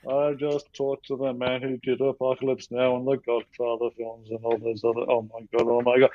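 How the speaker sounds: retold dumbstruck realisation; stunned muttering to self. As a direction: mumble it in a daze, pitch barely moving. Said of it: "So I walked away just going".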